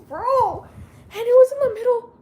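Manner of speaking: high, loud burst of embarrassed disbelief; excited outburst. Real, not acted. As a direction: blurt it out, still mortified. Embarrassed retelling, laughing.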